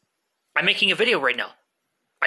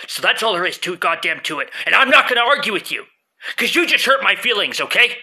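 building to a hurt, loud outburst; rising from firm to loud and hurt; arguing with myself; 2 semitones higher, 6 dB louder than usual. Acted. Quarrel with stoned self.

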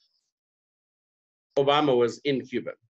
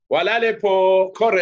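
loud, high, sung-out greeting; confident greeting; 6 semitones higher, 7 dB louder than usual. Retold or imitated. Trying two greetings on the next person, confidently calling. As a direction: call out both greetings proudly.

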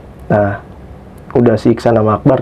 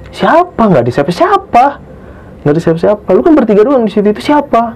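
high-pitched incredulous questioning; retold disbelief; 8 semitones higher than usual. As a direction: act out puzzled insistence that nobody is there. Friend questions the accusation, retold. (menirukan rasa heran dengan nada tinggi)

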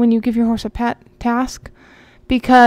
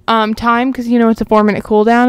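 normal talking voice

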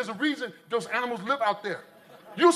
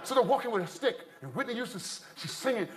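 ordinary talk

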